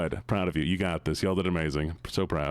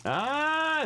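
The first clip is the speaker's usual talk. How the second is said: surprised squeal. Real, not acted. Surprised by gifted subs.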